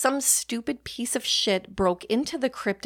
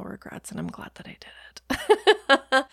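whispered, a little higher, like a naughty secret; guilty whisper; 4 semitones higher, 3 dB louder than usual. Real, not acted. To podcast listeners, admitting no regrets, in a whisper. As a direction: whisper it as a sheepish confession.

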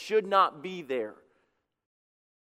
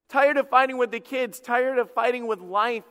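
higher, louder fed-up cry; exasperated outburst; 6 semitones higher, 4 dB louder than usual. Retold or imitated. Spouse at dinner telling the other they're tired, an exasperated outburst. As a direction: let the frustration burst out.

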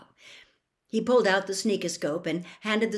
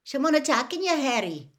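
sleepy; 7 semitones higher than usual. A narrator's reading. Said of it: "Seamus asked sleepily".

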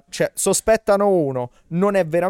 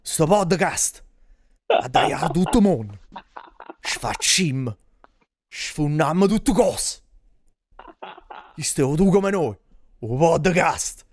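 neapolitan street slang; 2 semitones higher than usual. Pretend. Continues Neapolitan dialect bit.

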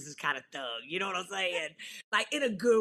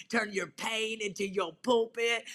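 usual speaking voice

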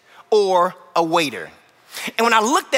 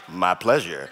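much lower, quieter smooth "My pleasure"; deep-voiced waiter; 14 semitones lower, 5 dB quieter, slower than usual. Pastor mimics waiter answering his wife in a deep voice. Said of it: "a taller guy with a deep voice".

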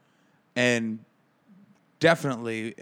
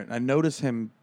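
usual speaking voice

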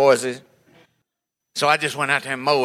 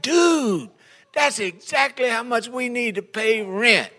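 high, excited neighbor voice; mock-astonished dude; neighbor's voice; 9 semitones higher, slower than usual. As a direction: jump way up as the surprised neighbor. Neighbor answers, acted quote.

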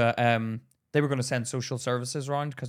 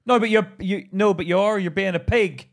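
louder, higher insisting; heated insistence; 5 semitones higher, 6 dB louder than usual. Real, not acted. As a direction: press your point hard. Heated argument with friend.

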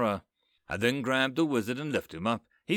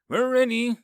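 loud, high shout; the king shouting back, loud and high; 11 semitones higher, 7 dB louder than usual. A narrator's reading. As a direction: yell it across the field. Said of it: "the king shouts".

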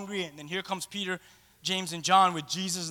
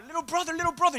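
higher, playful call; teasing big brother; 8 semitones higher, faster than usual. Retold or imitated. Peter greeting his little brother with playful teasing. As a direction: tease the kid brother. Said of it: "'cause he loves his little brother".

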